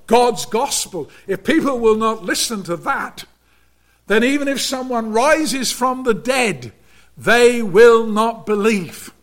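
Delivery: measured pulpit preaching, rising on key words. Acted. Preacher warning the congregation about unbelief, earnest sermon delivery.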